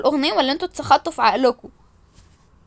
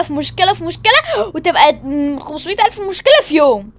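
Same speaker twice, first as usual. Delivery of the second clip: loud, raised-pitch tirade; exasperated rant; 5 semitones higher, 5 dB louder than usual. Acted. Fed up with problem after problem, exasperated rant. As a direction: pile it up, each problem hammered.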